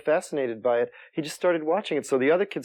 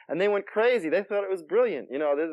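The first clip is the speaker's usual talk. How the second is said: ordinary talk, nothing marked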